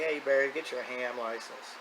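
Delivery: normal talking voice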